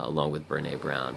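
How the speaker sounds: ordinary delivery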